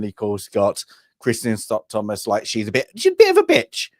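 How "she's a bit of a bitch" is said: high, put-on posh English accent; a snooty posh British accent. Mimicking posh character.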